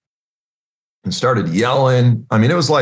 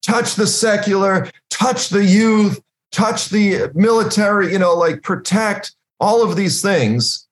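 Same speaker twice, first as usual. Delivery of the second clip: high, drawn-out pleading prayer; imploring prayer, acted out; crying out in prayer; 8 semitones higher than usual. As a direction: plead with God, pitched up and stretched slow. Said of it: "we're crying out".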